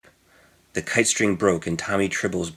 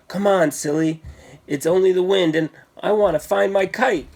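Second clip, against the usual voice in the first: put-on higher boyish voice, teasing and sure of himself; brave little boy; 8 semitones higher than usual. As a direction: coax a scared friend like a cocky boy. Tommy teasing, bravely insisting.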